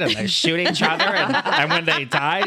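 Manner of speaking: high-pitched, over-alarmed mom parody; mock mom mode. Host mocking a parent scared of Fortnite, mock mom mode. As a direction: play up the mom's horror, laughing. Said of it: "I went into mom mode".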